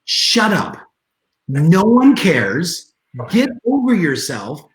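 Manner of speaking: blunt exasperation. Retold or imitated. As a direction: cut off the excuses, drawing out each word. Acts out blunt reply to excuse-makers.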